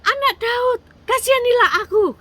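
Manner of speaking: very high, full-out acted yell; insistent, loud high-pitched plea. A narrator's reading. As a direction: shout for mercy at the top of the voice. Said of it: "dia teriak lagi lebih keras".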